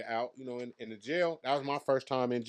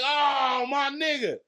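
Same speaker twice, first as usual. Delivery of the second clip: much higher and louder, shrieked mock realization; mock surprise; 9 dB louder, much slower than usual. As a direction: squeal in put-on astonishment. [name] realizing who he is.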